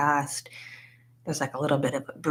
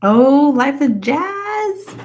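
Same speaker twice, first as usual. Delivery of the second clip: high, loud, drawn-out hello; big welcoming holler; excited greeting; 9 semitones higher, 8 dB louder, much slower than usual. Real, not acted. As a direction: sing out the viewer's name, stretched and delighted. Greeting a viewer who just joined.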